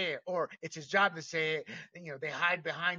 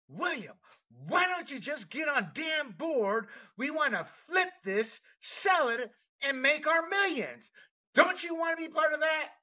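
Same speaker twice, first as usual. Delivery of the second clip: imitating a yelling board member; 9 semitones higher, 5 dB louder than usual. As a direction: yell it like an irate board member, pushing hard. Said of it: "board member once just yell at me".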